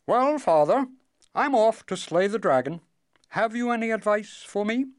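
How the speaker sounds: put-on high, loud eager young man; eager and bold. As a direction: boast it out cheerfully. Strong son voiced, bold.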